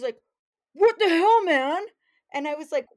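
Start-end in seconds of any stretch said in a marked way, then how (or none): 0.7-1.8 loud high angry yell at a friend. Retold or imitated. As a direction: snap at him, furious and shrill with pain. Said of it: "and she's pissed".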